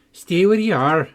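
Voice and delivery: loud call over a wall. A narrator's reading. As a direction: shout it across to her. Calling to girl over wall.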